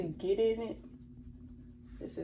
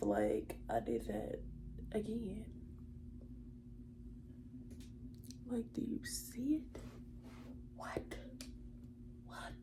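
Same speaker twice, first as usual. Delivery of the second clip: whisper; 11 dB quieter than usual. Real, not acted. Whispering to the camera while working.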